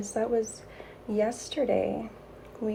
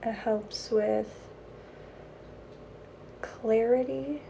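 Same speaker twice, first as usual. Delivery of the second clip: airy breathy mystical healer; 4 dB quieter than usual. Acted.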